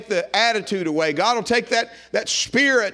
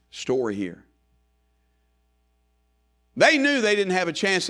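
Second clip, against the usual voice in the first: ordinary talk, nothing marked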